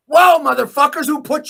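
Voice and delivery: high, loud angry yell; furious shout. Real, not acted. Raging at the government, furious shout. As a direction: explode at them.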